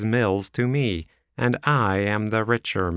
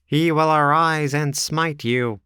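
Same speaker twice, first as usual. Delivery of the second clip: higher, louder fiery curse; cried out; 9 semitones higher, 4 dB louder than usual. Acted. Drebber cursing Ferrier, a higher loud cry. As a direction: call down judgment. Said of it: "cried young Drebber".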